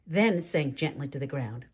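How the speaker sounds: plain reading voice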